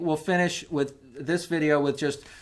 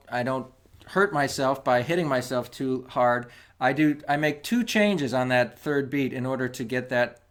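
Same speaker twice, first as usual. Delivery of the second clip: ordinary talk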